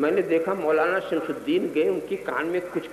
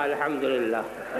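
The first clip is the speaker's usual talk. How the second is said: drawn-out, lower Alhamdulillah; slow misplaced thanks; 4 semitones lower, 4 dB quieter, much slower than usual. Retold or imitated. Deaf man thanks God at wrong answer, slow and low. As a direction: say it slowly and contentedly, lightly. Deaf man's mistaken reply.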